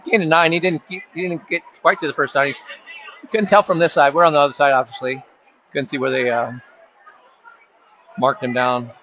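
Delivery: live sports call, running commentary pace; play-by-play. Acted. Announcer calling the game for listeners, live play-by-play. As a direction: narrate each play as it unfolds.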